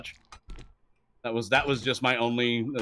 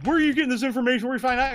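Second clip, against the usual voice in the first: higher, fast mock interrogation; mock accusing fans; 11 semitones higher, much faster than usual. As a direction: fire off their demands, mocking. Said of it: "getting my ass reamed by".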